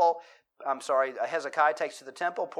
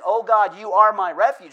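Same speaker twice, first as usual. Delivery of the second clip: fervent prayer; 4 semitones higher, 10 dB louder than usual. Shown as a demonstration. Contrast: prayer to God.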